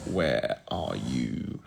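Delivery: low, very slow singsong; low drawled-out search call; drawn-out searching. Real, not acted. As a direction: stretch it out low while hunting for something. Searching for the Muppets list.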